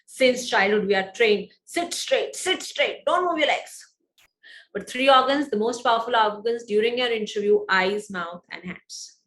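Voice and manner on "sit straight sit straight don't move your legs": higher, mock-scolding parent; a parent nagging about posture. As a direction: nag like a parent at a slouching child.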